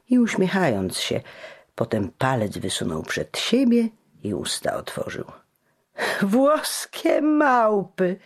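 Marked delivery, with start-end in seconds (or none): 6.2-8.2 put-on high, slow drunken jeer; shouted insult. A narrator's reading. Drunk cobbler jeers at the Italians, shouting. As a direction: yell it out at them, mocking. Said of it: "krzyknął w głos".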